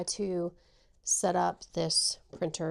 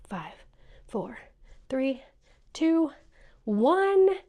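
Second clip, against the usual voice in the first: excited countdown; 4 semitones higher, 6 dB louder, much slower than usual. Real, not acted. Crafter counting down the final seconds, with rising excitement. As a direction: build each number higher and louder.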